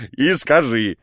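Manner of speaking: the same words again, now through a laugh; laughing repeat. Acted. The guest laughing as he repeats his order.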